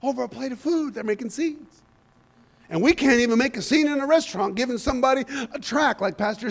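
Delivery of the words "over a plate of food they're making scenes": softer, understated repeat; quiet incredulity. Real, not acted. Preacher repeats the absurdity to the congregation, quietly incredulous. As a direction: let it drop to a hushed aside.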